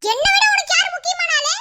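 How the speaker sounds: high, pushy jealous question; jealous demand. Acted.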